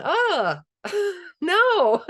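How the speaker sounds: high squeaky mock dismay with a laugh; mock horror. Gardener mocking people who reject edible weeds, high laughing mock horror. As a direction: play the horrified gardener spotting a weed, laughing.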